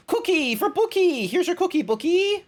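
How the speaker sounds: squeaky high treat-giving voice; high cutesy voice. Acted. Streamer handing a chatter a cookie, squeaky high. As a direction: hand out the cookie like to a pet, way up high.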